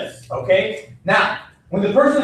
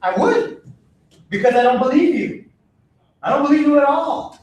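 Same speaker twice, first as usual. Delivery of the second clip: ordinary talk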